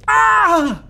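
loud high scream of fright; startled scream. Real, not acted. Streamer screams when the game jumps at him, frightened scream. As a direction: let out a real startled scream.

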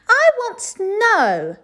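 high, loud, very slow drawn-out whine; whiny demand. A narrator's reading. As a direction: stretch the refrain out. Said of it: "she said to the cook".

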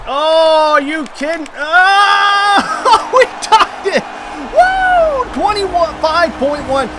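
very high, loud yelling; ecstatic shout. Real, not acted. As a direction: scream with joy at the tying goal. Ties game in last seconds.

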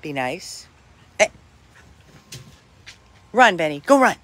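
ordinary talk

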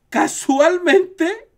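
high, loud and very slow, dripping irony; sarcastic drawn-out. Acted. Streamer telling chat about Thundercats, drawn-out sarcastic emphasis. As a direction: stretch it out with mock innocence.

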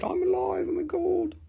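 lightly high, soft crystal voice; small high whisper of a crystal. Pretend. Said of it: "They said".

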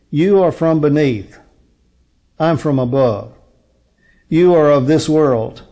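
usual speaking voice

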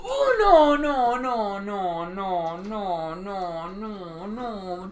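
long deflating wail of loss; despairing moan. Real, not acted. Despair after dying in game.

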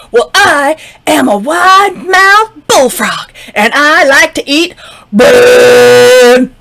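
loud, slow, proud frog boast; boastful frog. Acted. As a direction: puff up and boast like a brash frog.

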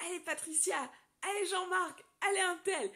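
high, loud sideline cheering; cheering names. Retold or imitated. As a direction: shout encouragement to runners. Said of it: "j'encourageais les gens".

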